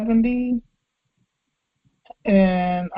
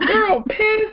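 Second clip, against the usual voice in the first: "pissed" with an exploded P, pitched up; hard P on pissed; 10 semitones higher than usual. Retold or imitated. Co-host echoing the hard P on "pissed". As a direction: hit the P of "pissed" hard and high. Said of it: "the extra emphasis on that P".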